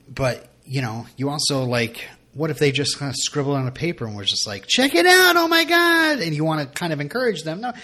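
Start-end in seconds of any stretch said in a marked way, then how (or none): 4.7-6.2 loud, very high mock excitement; hammed-up gushing praise. Parent overpraises a child's scribble, hammed-up gushing. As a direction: gush over it in a big fake-thrilled voice.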